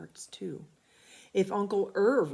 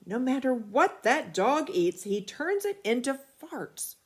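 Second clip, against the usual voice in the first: louder, higher angry dad; roaring father; 7 semitones higher, 6 dB louder than usual. A narrator's reading. Father roaring at the family about the dog, angry and loud. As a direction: bellow in frustration. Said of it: "roared Father".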